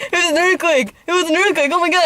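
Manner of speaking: high, loud imitation of a frantic dad; mock-frantic shouting; panicked yelling. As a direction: yell it high and urgent, mimicking an alarmed father. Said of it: "yelling at everyone".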